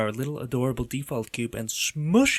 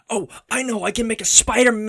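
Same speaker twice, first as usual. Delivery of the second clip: excited idea; 9 semitones higher, 6 dB louder, faster than usual. Real, not acted. Sudden idea for the monster.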